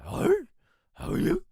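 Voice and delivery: squeaky, breaking cartoon dog voice; Scooby-Doo voice. Describing his breaking voice, Scooby-Doo squeak. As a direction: crack it up high like Scooby-Doo. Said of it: "I sounded like Scooby-Doo".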